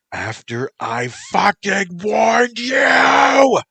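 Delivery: high, slow, screaming rage; furious scream. Acted. Motoko screaming into her lover's ear, furious shriek. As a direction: shriek it right into his ear. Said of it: "Motoko shouted into his ear".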